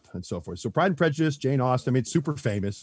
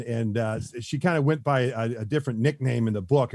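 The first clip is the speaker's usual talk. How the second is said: usual speaking voice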